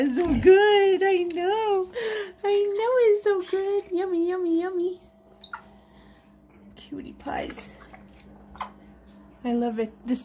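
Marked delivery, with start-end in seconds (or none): 0.0-5.0 sing-song yummy yummy for the pet. Real, not acted. Doting baby talk to bird. 6.7-7.7 soft whisper to the birds; whispered endearment to the sleeping pets. Real, not acted.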